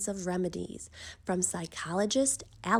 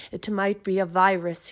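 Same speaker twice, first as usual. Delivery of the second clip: a touch of doctor's authority, louder; authoritative announcement; 8 dB louder than usual. A narrator's reading. Doctor gives his verdict to the family, mildly authoritative. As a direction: declare it like a lightly voiced expert. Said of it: "he announced with authority".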